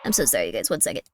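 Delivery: apologizing while stifling a sneeze; strained, about to sneeze; holding back a sneeze. Real, not acted. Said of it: "I can't sneeze".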